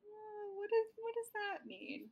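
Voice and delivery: high, baffled question; confused. Retold or imitated. Viewer confused by the creature name, high and baffled. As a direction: sound puzzled by a weird name. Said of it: "where I was like".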